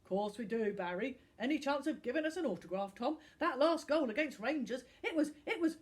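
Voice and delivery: loud eager lout; bully begging his football hero for an autograph; fawning thug. Acted. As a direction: gush like a starstruck thug. Thug Nigel fawns over Tom.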